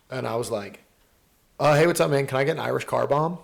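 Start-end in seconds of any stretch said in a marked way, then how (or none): 1.6-3.4 louder, upbeat mock tourist greeting; retold cheery customer; eager bar order. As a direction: call out brightly to a bartender, re-enacting it.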